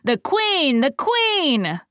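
high, loud crowd cheer; people shouting. A narrator's reading. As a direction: hail her as the crowd roars. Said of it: "while the people shouted".

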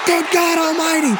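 very high, full-throated commentator yell; Jim Ross shout. Retold or imitated. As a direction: scream it like a ringside call of a huge fall. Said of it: "he had the best reactions".

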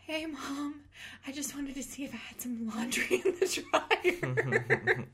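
high sing-out excuse breaking into laughter; fake casual. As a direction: play innocent in a high voice, badly faked. Acting fake excuse to her mom.

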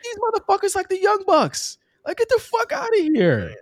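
high, scornful caricature voice; mock voice dismissing rivals; mocking put-on voice. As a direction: sneer it in a silly high voice. Mocking the tag team.

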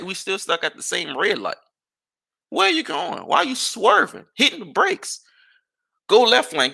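high, exasperated rapid questions; mock road rage yell. Driver reenacting shouting at a swerving car, high mock yell. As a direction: hammer him with questions, half yelling.

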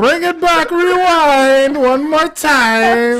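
high, loud Jamaican DJ chant; mock Jamaican. Host calling for a replay, loud mock Jamaican chant. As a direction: holler it like a Jamaican selector, stretching the last words. Mimicking Jamaican DJ rewind call.

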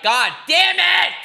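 long, high, loud shout; exasperated yell. Real, not acted. Speaker annoyed at always saying "like", exasperated shout. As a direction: yell it out, drawn out in frustration. Exasperated at own verbal habit.

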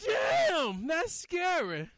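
high, slow spooky pumpkin voice; jack o lantern voice. Acted. Spooky podcast intro drop.

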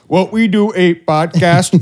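hammed-up Indian accent, high and loud, laughing through it; a clumsy joke accent, cracking up; bad Indian accent. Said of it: "That was just an Indian guy".

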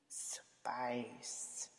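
unvoiced whisper, thinking it over; whispered musing. Real, not acted. Pondering which spice she'd be, whispered. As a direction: breathe the word while pondering. Thinking aloud about the question.

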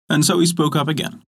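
ordinary delivery

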